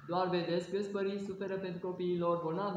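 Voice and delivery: ordinary talk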